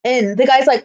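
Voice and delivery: normal talking voice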